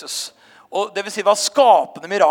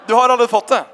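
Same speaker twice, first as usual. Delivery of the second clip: louder, higher repeat with a grin; emphatic repeat; 4 semitones higher, 7 dB louder, faster than usual. Retold or imitated. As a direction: say it again, louder and firmer. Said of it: "Så sa jeg bare". (sier det igjen, høyere og bestemt til den gråtende toåringen)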